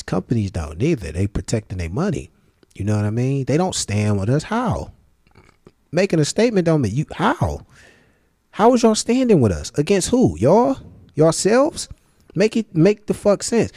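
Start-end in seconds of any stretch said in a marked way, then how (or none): 4.4-13.7 high-pitched incredulous questioning; exasperated disbelief. Real, not acted. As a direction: demand an answer in a high, disbelieving voice. Disbelieving challenge to companies' slogan.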